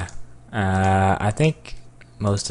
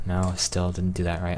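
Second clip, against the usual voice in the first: ordinary talk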